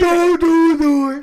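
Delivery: slow, high, loud drawn-out cry; hammed-up yell. Butting into friends' row, slow hammed-up yell. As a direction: stretch each word in a big shout.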